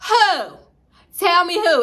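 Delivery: high and loud, insisting; demanding shout. Retold or imitated. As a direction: press him hard for a name. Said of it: "Y'all on it, bitch".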